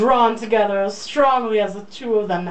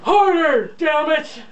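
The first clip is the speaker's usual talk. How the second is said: loud, higher, hammed-up old man demanding; old man breasts voice; 9 semitones higher, 4 dB louder than usual. Cohost voicing the heroine's breasts, cranky old man. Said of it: "Your breasts sound like an old man?".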